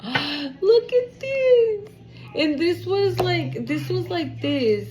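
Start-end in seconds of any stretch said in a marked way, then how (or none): 0.0-1.9 gasping, much higher and very slow; real amazement at the card; gasp of surprise. Real, not acted.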